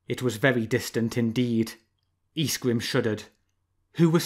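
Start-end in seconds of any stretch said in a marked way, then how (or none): none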